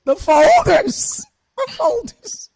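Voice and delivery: high, slow, loud wail through tears; sobbing plea. Retold or imitated. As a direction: cry out the words as a distraught child. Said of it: "all I could say was".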